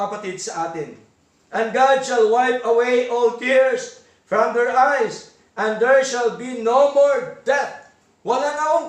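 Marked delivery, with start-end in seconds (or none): 1.5-7.9 slow, measured reading of the verse; slow, solemn sermon recitation; solemn scripture reading. Acted. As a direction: read it out word by word with weight. Reads scripture slowly, louder.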